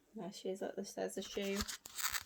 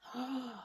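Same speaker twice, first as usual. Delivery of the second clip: gasp; 4 semitones higher, 8 dB quieter than usual. Acted. Dress transformation.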